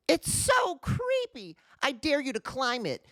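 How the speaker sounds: put-on a boy's teasing dare, pitched high; challenging. A narrator's reading. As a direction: goad her into climbing. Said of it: "challenged her".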